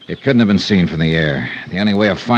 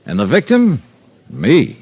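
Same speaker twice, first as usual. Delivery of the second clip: raised, bright teaser for the next episode; radio promo read; 4 semitones higher than usual. Acted. Star teasing tomorrow's episode to radio listeners, promo read. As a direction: sell tomorrow's show to the listeners.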